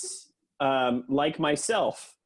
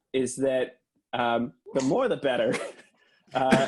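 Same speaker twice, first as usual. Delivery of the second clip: ordinary talk, nothing marked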